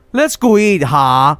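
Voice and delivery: quoting The Room; Tommy Wiseau impression. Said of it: "looks like Tommy Wiseau now".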